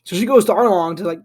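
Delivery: ordinary delivery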